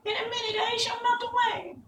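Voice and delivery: contained witch's scream, pitched far up. A narrator's reading. Witch blames Dorothy as she melts, high-pitched mock scream. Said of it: "she screamed".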